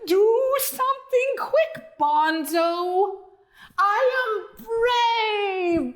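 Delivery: high, slow crying plea of a scared dog; terrified howl. Acted. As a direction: wail it out in terror. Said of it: "Duke howled in terror".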